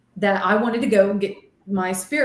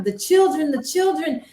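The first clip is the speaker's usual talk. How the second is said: distraught cries; 7 semitones higher than usual. Retold or imitated. Medium overwhelmed by spirits at the school, high anguished cries. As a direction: wail it like a panicked vision. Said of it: "I start crying".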